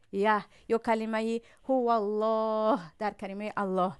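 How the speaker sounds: ordinary delivery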